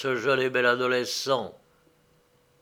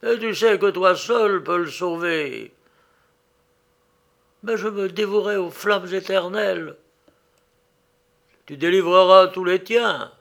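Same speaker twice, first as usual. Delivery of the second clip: high, loud ghostly voice; lightly voiced solemn phantom; 5 semitones higher, 5 dB louder than usual. A narrator's reading. The ghost bargains with the girl.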